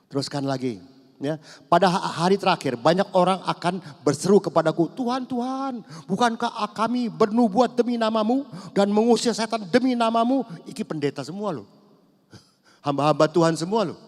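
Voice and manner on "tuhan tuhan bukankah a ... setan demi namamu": high, loud protest of the self-righteous; whiny demanding preacher; indignant pastors. Retold or imitated. (pendeta menuntut dengan suara tinggi)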